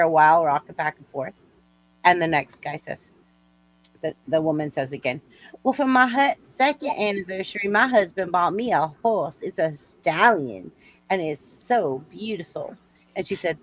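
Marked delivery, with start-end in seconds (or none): 5.6-12.8 Southern lady showing off, with long pauses; Southern accent. Acted. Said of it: "I like your Southern accent".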